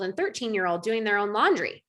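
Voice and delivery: ordinary talk, nothing marked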